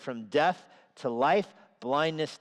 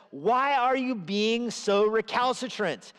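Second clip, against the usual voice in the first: wisdom calling out; 5 semitones higher than usual. Acted. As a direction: call out to the sinner like a stern teacher. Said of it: "the voice of wisdom says".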